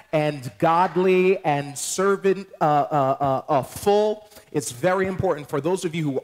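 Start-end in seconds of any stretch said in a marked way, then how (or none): 0.1-4.3 preaching stretch. Real, not acted. Pastor to the church, slow preaching emphasis. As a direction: stretch each word like a preacher.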